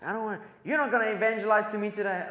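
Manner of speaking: mock outrage at being preached to; imitating angry woman. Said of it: "And she gets angry".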